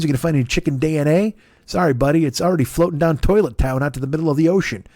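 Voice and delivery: ordinary talk, nothing marked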